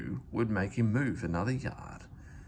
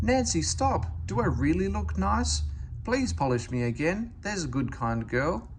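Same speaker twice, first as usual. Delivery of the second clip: high, eager begging; pleading engine; 7 semitones higher than usual. A narrator's reading. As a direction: coax her to come back.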